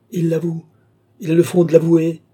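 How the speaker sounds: high, loud outraged exclamation; indignant cry. Acted. Countess outraged at the duke's admission, crying out. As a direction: cry out at his nerve. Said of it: "s'écria madame du Barry".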